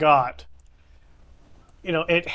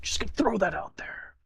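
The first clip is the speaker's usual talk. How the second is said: whisper; 9 dB quieter, faster than usual. Acted. Whispered exit line.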